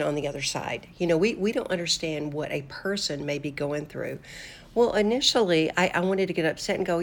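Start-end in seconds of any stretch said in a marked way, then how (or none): none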